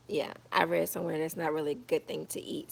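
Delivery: ordinary talk, nothing marked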